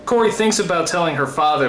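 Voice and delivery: ordinary delivery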